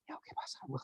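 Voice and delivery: faint whispered reply; very soft, all air and no voice; acted lost voice. Employee thanking boss who wishes him well, voiceless whisper. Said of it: "You've lost your voice?".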